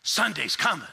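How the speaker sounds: slow, measured sermon cadence; slow preaching. Retold or imitated. Pastor starting his famous sermon, slow. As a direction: build it slowly from the pulpit. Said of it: "He said".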